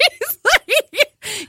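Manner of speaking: high squealing laughter; laughing hard. Real, not acted. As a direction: laugh through the words. Laughing with shout.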